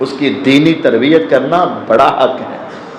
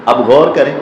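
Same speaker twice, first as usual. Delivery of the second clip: ordinary delivery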